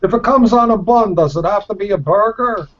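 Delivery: put-on high, loud Brooklyn accent ad read. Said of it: "I had to do a Brooklyn accent".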